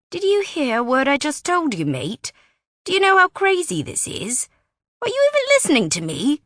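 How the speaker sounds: can't believe he's being ignored; exasperated. A narrator's reading. Wes confronts Riley who ignores him, exasperated outburst.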